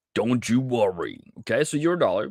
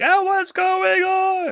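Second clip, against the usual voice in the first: much higher, louder, slower, squealing "Oh, what's going on?"; mock panicked scream; 6 dB louder, slower than usual. Viewers panicking over yesterday's drop, hammed-up high shout. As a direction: shriek it like a panicking trader. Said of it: "like, screaming".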